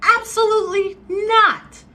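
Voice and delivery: high, loud, emphatic refusal. Real, not acted. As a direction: shut it down hard and high.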